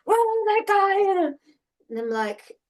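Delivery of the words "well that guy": high, loud mock-upset yell; upset outburst. Retelling boyfriend running into the house, upset yell. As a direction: imitate someone worked up and yelling. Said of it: "was so upset".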